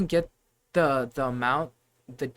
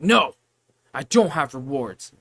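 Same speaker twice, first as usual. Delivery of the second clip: a louder mock snippy customer; grumpy customer; 7 dB louder than usual. Cashier mimicking a customer snapping that they have no rewards. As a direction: snap back like the annoyed customer. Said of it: "And they're like".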